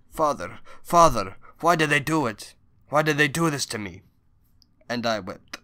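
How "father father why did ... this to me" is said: a raised, pleading father's cry, lightly voiced; grieving father's plea. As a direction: cry out for a beaten daughter, louder and higher. Daughter's father pleading, weeping.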